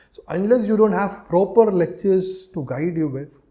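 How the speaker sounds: ordinary talk, nothing marked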